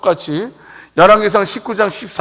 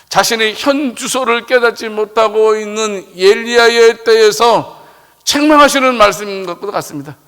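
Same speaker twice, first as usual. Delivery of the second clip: normal talking voice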